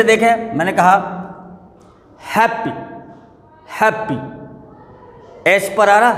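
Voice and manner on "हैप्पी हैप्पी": Happy with force on HAP, PI dropping away; stress on first syllable. Shown as a demonstration. Teacher showing where stress falls, first syllable stressed. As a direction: punch HAP, let PI slip out softly. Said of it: "हैप पे आ रहा".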